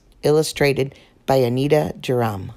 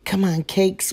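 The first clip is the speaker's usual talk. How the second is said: plain reading voice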